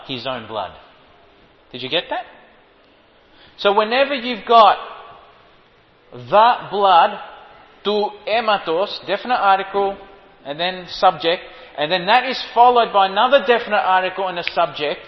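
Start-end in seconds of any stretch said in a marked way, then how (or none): 3.6-15.0 loud, driving preacher voice; preaching. Acted. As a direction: hammer it home to the congregation. Preaching at full force.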